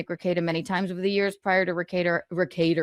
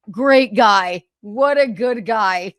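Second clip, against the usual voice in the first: sarcastic; 3 semitones higher, 8 dB louder, much slower than usual. Real, not acted. Sarcasm about cruel response.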